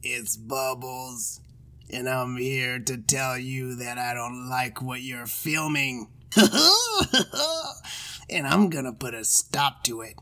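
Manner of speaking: slow, low evil clown with shrieking cackles; creepy clown threat, hammed cackles. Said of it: "I'll do the evil clown".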